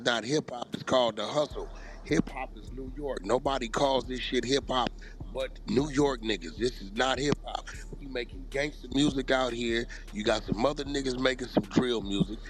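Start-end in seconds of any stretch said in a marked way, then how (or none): none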